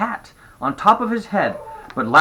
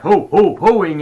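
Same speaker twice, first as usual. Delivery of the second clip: lightly mimicked Santa, louder; Santa's ho-ho; 4 semitones higher, 8 dB louder, slower than usual. A narrator's reading. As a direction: punch out each ho a bit higher. Imitates Santa's ho-ho in retelling.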